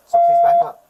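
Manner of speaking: sudden high desperate yell; panicked shout. Real, not acted. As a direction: scream it, terrified and cornered. Armed man panics at officer.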